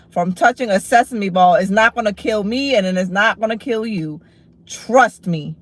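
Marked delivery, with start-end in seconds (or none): none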